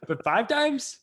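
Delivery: high, incredulous "five times?"; squeaky mock outrage; mock disbelief. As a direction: act shocked at the count.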